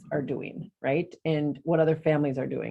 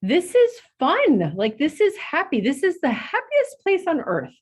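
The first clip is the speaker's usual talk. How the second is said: giddy joy; 11 semitones higher, 3 dB louder than usual. Retold or imitated. Mom dancing at the parade, gushing high and happy. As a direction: gush like a thrilled child at Disney. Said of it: "I was like".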